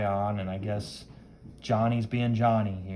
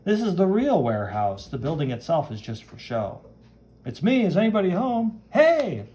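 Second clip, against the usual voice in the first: animated, higher voice building to a call; breezy comic-book hero; 6 semitones higher, 4 dB louder than usual. Acted. Hero enters the warehouse calling out, animated and breezy. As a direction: play an impressed hero showing himself in.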